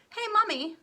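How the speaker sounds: high, loud demanding yell; bratty shout. Acted. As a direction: call mummy like a spoiled child. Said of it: "shouted Veruca Salt suddenly".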